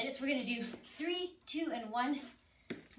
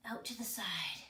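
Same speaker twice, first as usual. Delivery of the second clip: whispering; 5 semitones lower, 7 dB quieter than usual. Real, not acted. Coaching cue, whispered.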